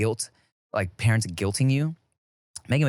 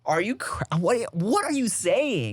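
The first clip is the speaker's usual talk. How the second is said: high, drawn-out incredulous question; stammer, then stretch the question in mock disbelief; retold disbelief; 7 semitones higher, slower than usual. Son reacting to mom's claim, incredulous high protest. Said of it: "I was like".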